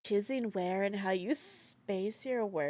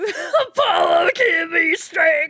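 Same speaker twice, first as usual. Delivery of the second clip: put-on southern accent; 9 semitones higher, 13 dB louder, much slower than usual. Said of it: "Someone's getting a thick southern accent".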